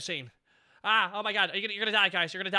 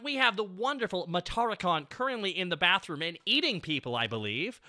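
ordinary talk

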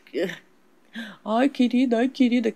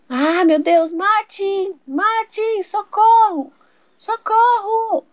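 much higher, calling for help louder and louder; panicked cry for help; 8 semitones higher, 3 dB louder, much slower than usual. Acted. Mother screaming to husband for help, high panic. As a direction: scream for Martin, terrified.

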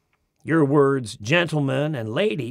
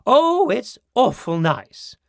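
English lady; 7 semitones higher, 5 dB louder, slower than usual. Retold or imitated. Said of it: "Your ladies say".

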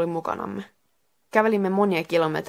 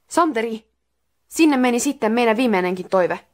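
higher-pitched, restrained mock anger; furious yell as narrated; 4 semitones higher, 4 dB louder than usual. Miika scolds Santeri for the lost last wish, mildly angry. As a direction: scold with a touch of outrage, no full shout. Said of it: "Miika huusi raivoissaan".

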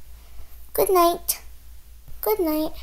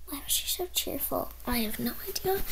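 whisper; 11 dB quieter than usual. Acted. Kid whispers about clerk.

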